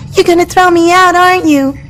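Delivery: usual speaking voice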